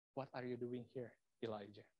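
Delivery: soft, tender question; gentle voice. Acted. God gently asking the fleeing prophet, soft and tender. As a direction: speak it softly as a gentle God. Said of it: "Tuhan berkata dengan suara yang lembut".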